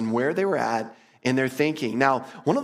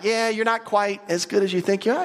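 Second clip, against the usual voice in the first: gentle mock verdict; 8 semitones higher than usual. Jesus' mock report card.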